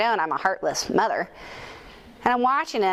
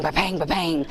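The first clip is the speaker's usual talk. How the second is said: bouncy ba-bang settling down at the end; ball bouncing sound; 2 semitones lower than usual. Retold or imitated. Mom mimics son's basketball bouncing indoors, falling bounce sound. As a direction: copy the thud of a bouncing ball, dropping off.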